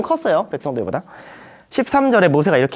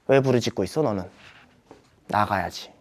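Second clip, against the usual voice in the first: God's quiet rebuke; 8 semitones lower, 6 dB quieter than usual. Retold or imitated. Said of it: "하나님이 모세한테 뭐라고 하세요".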